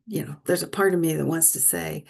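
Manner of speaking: ordinary delivery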